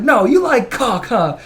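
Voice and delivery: high, slow, loud teasing comeback; cheeky lady's retort. Retold or imitated. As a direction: fire it back at him with a grin. Lady retorting to Tony on stage.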